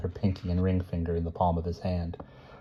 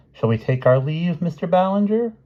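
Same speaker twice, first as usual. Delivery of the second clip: lightly voiced, higher-pitched polite invitation; playful formality; 11 semitones higher, 4 dB louder than usual. A narrator's reading. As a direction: climb high toward the name. Quoted mock-formal address, voiced high.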